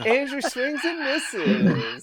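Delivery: slow words broken by laughter; laughing. Real, not acted. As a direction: laugh through the whole line. GM laughing at failed kick.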